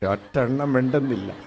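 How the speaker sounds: usual speaking voice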